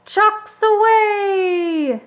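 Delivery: high, loud and drawn out very slowly; hearty cry. A narrator's reading. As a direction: call it across the airfield like a flying ace. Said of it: "Snoopy cried".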